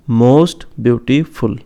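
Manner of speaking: most beautiful said very slowly and deliberately; slow careful demo. Shown as a demonstration. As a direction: spell it out for the class at a crawl. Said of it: "teacher demonstrates degree form". (धीमे, साफ़ शिक्षक वाले अंदाज़ में)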